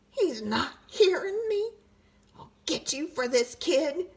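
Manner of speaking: high, grumbling threat; high sulky tooth voice. A narrator's reading. Tooth vowing revenge on the brushing boy, high. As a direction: mutter a promise of revenge.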